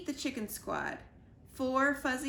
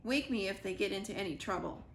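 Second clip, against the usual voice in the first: usual speaking voice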